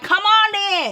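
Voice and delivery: high-pitched, goading dare; retold fight challenge. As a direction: egg her on to fight. Retold daring her to fight.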